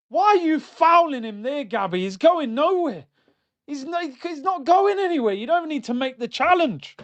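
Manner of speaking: frustrated berating. Real, not acted. Berating a player for fouling.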